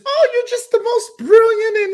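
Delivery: high, gushing sycophant voice; mocking fawning fans in a squeaky tone; mock flattery. Vlogger mocking echo-chamber flatterers, high gushing voice. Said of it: "People want to just hear whatever they're saying is".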